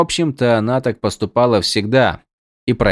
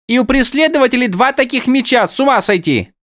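high character voice; 3 dB louder than usual. Acted. Windel voiced, far high voice.

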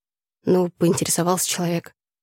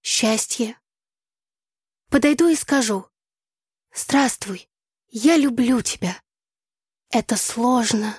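slow, higher declaiming voice; solemn poem recitation; 6 semitones higher, slower than usual. A narrator's reading. Tasya rehearses a love poem for her friend, solemn recitation. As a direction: recite verse with drawn-out line ends. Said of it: "начала торжественно Тася".

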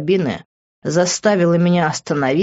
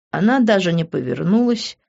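plain reading voice

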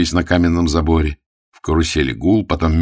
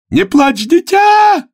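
very high, loud shriek; urging shout; 7 dB louder, slower than usual. Acted. Voice in the carousel cheers its cockroach, shrieking high. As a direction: shriek it to urge on the runner. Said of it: "Голос в карусели".

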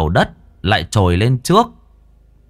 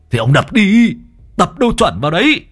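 gruff order; 9 semitones higher, 3 dB louder than usual. Acted. Boss orders him to strike.

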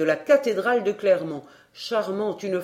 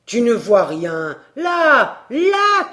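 jab each "là" at him impatiently; exasperated shout; 2 semitones higher, 7 dB louder, slower than usual. A narrator's reading. Professor pointing out the bellows to the boy, exasperated shout.

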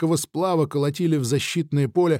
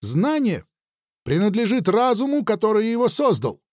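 lightly voiced thin, girlish voice; a hint of a child speaking; thin childlike voice; 7 semitones higher than usual. The thinker answers Nikita in a lightly thin, childlike voice. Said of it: "тонкий, не то женский, не то детский голос".

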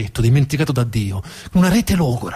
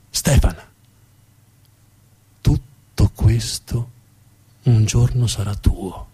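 slow, lower, weighty father voice; father's solemn promise; 4 semitones lower, much slower than usual. Acted. Father telling young Stefan he will inherit everything, slow and solemn. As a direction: hand him the empire, very slowly. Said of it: "suo padre gli disse".

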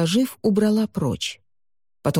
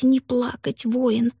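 rising whisper; 5 semitones higher, slower than usual. A narrator's reading. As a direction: whisper climbing higher. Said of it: "твердил он себе".

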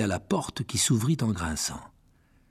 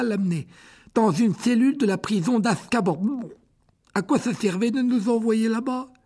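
indignant Ron; 11 semitones higher than usual. Acted. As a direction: vent the frustration at Hagrid. Ron voiced, indignant rant.

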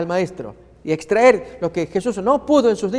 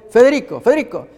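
loud, high call across the party; 6 semitones higher, 7 dB louder than usual. Acted. Uncle calling Federico to his gift, loud and high. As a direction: call the name out to the kid.

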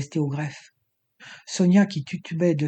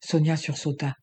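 plain reading voice

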